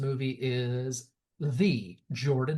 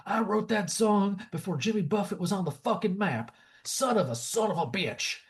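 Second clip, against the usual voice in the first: hammed-up aggrieved singer, pitched up; indignant singer rant; 5 semitones higher than usual. Retelling a movie singer's grievance, hammed-up indignant rant. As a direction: rant like a wronged musician, dragging out the final curse. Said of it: "and he's like".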